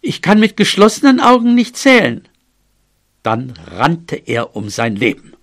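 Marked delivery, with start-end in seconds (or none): 0.0-2.3 high, raised mouse voice; high, urgent call. A narrator's reading. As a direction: shout back the excuse. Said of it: "rief er".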